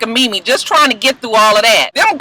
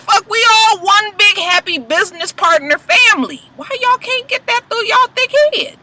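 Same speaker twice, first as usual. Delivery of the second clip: mock [name]; 8 semitones higher than usual. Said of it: "He's just like".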